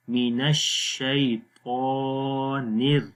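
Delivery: slow, drawn out, ra in الر kept thin; thin ra demo. Shown as a demonstration. Teacher showing thin ra in slow recitation. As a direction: draw out الشیطان slowly, thin ra. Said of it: "نیر تک باریک رہے گا".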